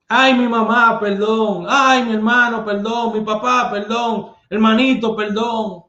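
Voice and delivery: high, pleading cries of perdón, a touch hammed-up; mock pleading thief. Caught thief begging the neighbours for mercy, mock panicked pleading.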